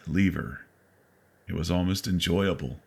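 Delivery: ordinary delivery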